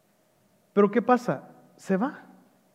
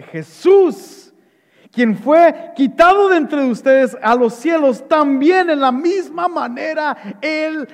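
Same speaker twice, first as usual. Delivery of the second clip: raised, ringing preacher's declaration; emphatic proclamation; 5 semitones higher, 3 dB louder than usual. Acted. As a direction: proclaim the promise, pitched up. Proclaims the promise from Acts. (proclamación enfática, voz alzada)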